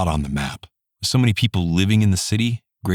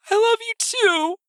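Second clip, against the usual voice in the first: put-on high small child reply; tiny tender child voice; little girl voice. A narrator's reading. Daughter answers mother.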